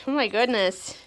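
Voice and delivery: usual speaking voice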